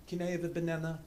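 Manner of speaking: soft, put-on French player; French accent. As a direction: ask with a mock French accent. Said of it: "like, French".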